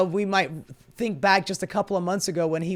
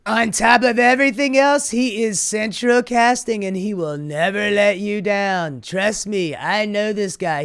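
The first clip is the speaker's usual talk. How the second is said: louder, brash politician impression; Donald Trump; 2 semitones higher, 5 dB louder than usual. Reading a quote about his Fed pick as a loud Trump impression. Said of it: "a little Donald Trump quote".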